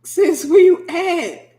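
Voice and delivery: high, loud and slow, stretched out; hammed-up shout across the room; loud teasing call. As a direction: holler it to snap them out of a daydream. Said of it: "you like".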